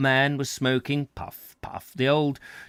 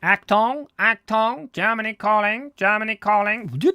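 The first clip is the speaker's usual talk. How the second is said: higher, louder sneering broadcaster call; Lord Haw-Haw radio voice; 8 semitones higher, 4 dB louder than usual. Retold or imitated. Reader voicing the radio for the farmer's family, broadcaster voice. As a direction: announce it like a wartime propaganda radio.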